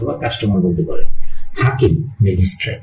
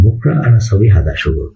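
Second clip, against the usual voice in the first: normal talking voice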